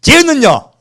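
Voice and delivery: high, loud whining jab; mock jealous Peter. Shown as a demonstration. Pastor demonstrating Peter's jealous line for the congregation, high loud whine. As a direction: throw the line out for the crowd to copy. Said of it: "다시 제가 할게요".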